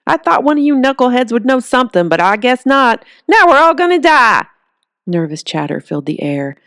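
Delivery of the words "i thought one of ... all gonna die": higher, louder doom-laden rant; exasperated despair. Acted. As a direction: give up loudly on everyone. Old man despairs loudly.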